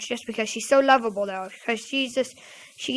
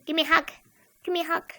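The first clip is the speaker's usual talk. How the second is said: lightly higher kid imitation, repeated identically; cousin's one-tone demand; 4 semitones higher, much faster than usual. Showing her only way of asking, pestering kid voice. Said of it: "No other tone, no other way to saying".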